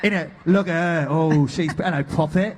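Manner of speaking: put-on hello Poppet in a cheeky London accent. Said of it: "I should have done a different accent for Cole".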